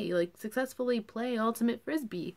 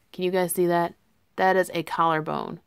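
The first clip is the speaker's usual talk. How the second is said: louder, proud and delighted; proud excited reveal; 7 dB louder than usual. Real, not acted. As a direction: show it off like a trophy. Proudly showing collarbone.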